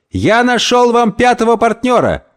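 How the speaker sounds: high, proclaiming call; pitched well up, proudly calling out; excited announcement. Acted. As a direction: announce it triumphantly to the room. Calling out news to friends.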